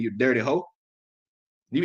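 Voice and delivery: usual speaking voice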